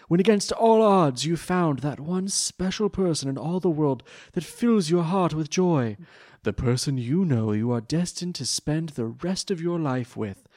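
a touch of Jean-Luc Picard, swelling grandly; Pegasus voice. Acted. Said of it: "in my Pegasus voice".

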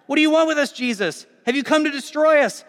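a loud, high cry from the possessed man; possessed man cries out at Jesus. A narrator's reading. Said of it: "cries out".

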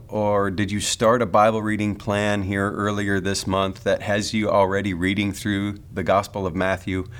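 usual speaking voice

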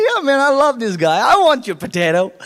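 high, enthusiastic mock buyer; delighted customer. Amused customers won over by potato seller, delighted.

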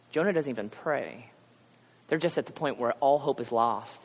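ordinary talk

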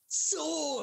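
SOY held long and very high; drawn-out SOY. Acted. Finishing a milk question as a gag, drawn-out soy. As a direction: punch out "soy" as a high, stretched punchline. Punchline echoing the soil gag.

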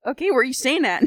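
startled daughter pressing mom; alarmed question. Acted. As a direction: demand it a bit too fast. Panicked question to visiting mom.

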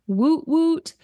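a hammed-up woot woot call; juggalo call. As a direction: call out like a juggalo hailing his crew. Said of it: "That is the call of the juggalo".

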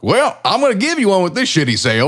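high, loud salesman bark; mock salesman shout. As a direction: play a pushy salesman.

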